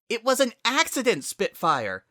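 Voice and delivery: high, loud defensive yell; yelling back. A narrator's reading. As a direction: shout back in protest. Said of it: "Sunset yelled back".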